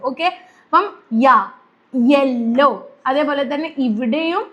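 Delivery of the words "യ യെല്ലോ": യ and യെല്ലോ slowly, with a clear initial y glide; ya sound demo. Shown as a demonstration. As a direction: spell out യ then യെല്ലോ slowly, like a teacher. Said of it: "യ എന്ന് പറയുന്നത്".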